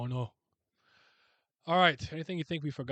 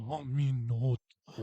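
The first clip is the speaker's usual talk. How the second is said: put-on Japanese accent. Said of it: "Nihon Nippon style".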